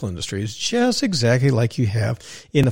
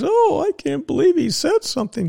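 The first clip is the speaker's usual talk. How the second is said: much higher, prissy mock outrage; mocking whine. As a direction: parody the offended puppy dogs. Mocking politically correct critics.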